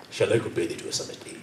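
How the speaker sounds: "Shall I compare thee to a summer's day?" whispered fast and low; quick, low whispered line; whispered take. Shown as a demonstration. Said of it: "It's not me".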